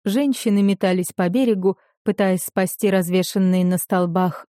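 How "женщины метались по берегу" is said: usual speaking voice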